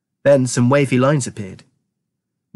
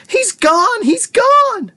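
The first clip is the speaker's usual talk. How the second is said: high-pitched, frantic scream; 5 dB louder, much slower than usual. A narrator's reading. Mother seeing her son vanish, screaming. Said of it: "and she screamed".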